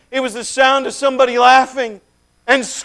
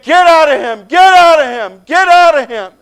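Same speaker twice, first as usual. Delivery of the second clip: loud forceful command, repeated; commanding shout; 5 semitones higher, 9 dB louder than usual. Acted. The stranger orders the demons out of the man, commanding shout. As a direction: drive the demons out with authority.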